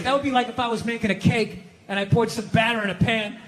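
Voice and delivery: usual speaking voice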